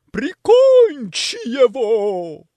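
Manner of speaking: slow, high howling command; howling scream. A narrator's reading. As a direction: howl it out to the sky. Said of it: "Её отробный вой сотрясает небо".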